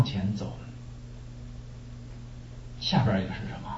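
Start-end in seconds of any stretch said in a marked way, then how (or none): 2.8-3.7 whispered to herself; a breathy aside, checking notes. Real, not acted. Preacher checking what comes next, whispered.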